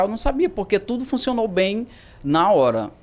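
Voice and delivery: normal talking voice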